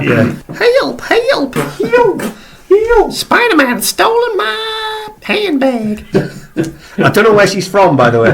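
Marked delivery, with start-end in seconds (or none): 0.8-6.0 put-on very high, slow shrill old-lady cry; old lady crying for help. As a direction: wail it out like a robbed granny. GM voices screaming Aunt May.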